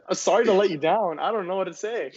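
high, exaggerated apologetic protest; mock apology. Hawaiian student letting down new classmates, mock apologetic. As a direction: push the apology up high, hammed-up.

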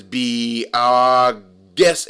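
imitating a French accent. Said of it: "Timon's in a French accent".

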